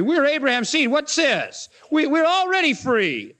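a hint of an outraged crowd; indignant Pharisees. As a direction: bristle at being called slaves.

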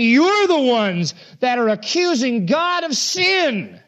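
high, slow counter-accusation; accusing. Retold or imitated. Preacher voicing Christ turning the tables, accusing. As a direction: throw the charge back, drawing it out.

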